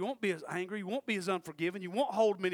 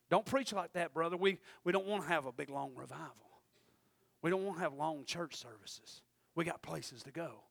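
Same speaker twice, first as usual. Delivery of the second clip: muttered complaint, much softer and lower; mock churchgoer; 5 semitones lower, 5 dB quieter than usual. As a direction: grumble like someone bored of long services. Voicing reluctant churchgoers.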